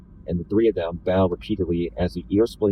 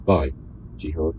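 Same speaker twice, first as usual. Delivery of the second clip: voice hitching; 2 semitones lower than usual. A narrator's reading. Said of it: "my voice hitches because I'm going to cry".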